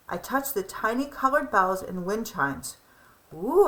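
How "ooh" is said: high, louder gasp of wonder; delighted ooh. Acted. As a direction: marvel at the tree. Child sees decorated tree.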